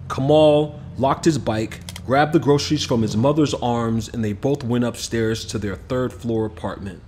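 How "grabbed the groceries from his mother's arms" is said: plain reading voice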